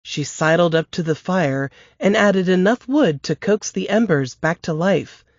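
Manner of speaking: plain reading voice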